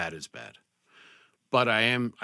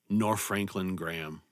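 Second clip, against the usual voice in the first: ordinary delivery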